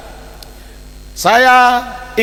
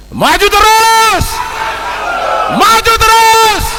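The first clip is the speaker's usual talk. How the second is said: rallying slogan shout; 10 semitones higher, slower than usual. Real, not acted. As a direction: shout the slogan to rouse the crowd. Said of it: "saya ingin mengajak kita semua untuk mengembakikan slogan dari Soksi". (teriakan yel penuh semangat)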